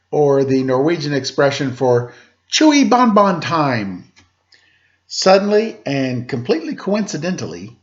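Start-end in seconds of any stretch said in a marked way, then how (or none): 2.5-4.1 high, slow hammed-up monster chant; mock dinosaur battle cry; hungry dinosaur cry. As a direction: call it out like a feast bell.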